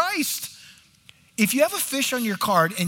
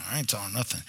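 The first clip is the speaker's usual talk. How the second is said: sullen mutter; 9 semitones lower, 9 dB quieter, faster than usual. Retold or imitated. Husband's sullen reply acted.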